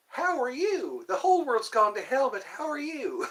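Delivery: high, lilting cartoon greeting; hammed-up, sing-song cheerful cartoon voice.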